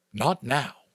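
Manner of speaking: ordinary delivery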